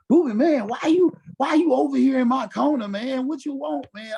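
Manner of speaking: a lightly voiced teammate, high-pitched; high mock Randy voice. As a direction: tease it like an annoyed star. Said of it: "He used to be like".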